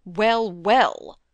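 loud, falling, mildly irritated; exasperated father, restrained; a little angry. A narrator's reading. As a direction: cut in with a touch of temper. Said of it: "getting a little angry in his turn".

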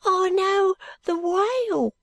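put-on high, thin ragged boy; street urchin voice. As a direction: speak like a timid street boy.

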